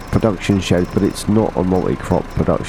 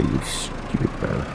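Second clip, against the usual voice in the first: slow breathy whisper of an insult; 5 semitones lower, 11 dB quieter, slower than usual. Acted. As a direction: hiss it under your breath. Muttered insult to game character.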